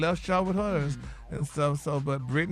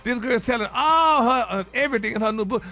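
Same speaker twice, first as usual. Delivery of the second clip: high, loud burst of gossip; juicy gossip exclamation; excited gossip; 5 semitones higher, 4 dB louder than usual. Real, not acted. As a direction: spill the tea with a loud thrill. Gossip host hyping up story.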